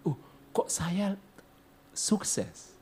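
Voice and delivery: soft, whispered kok saya sukses; sick man recalling his surprise at success in a whisper; whispered wonder. Retold or imitated. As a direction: breathe it as a secret puzzle.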